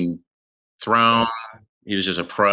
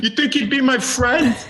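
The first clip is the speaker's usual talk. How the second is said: mock fan. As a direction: ask it like a starstruck fan, pitched up.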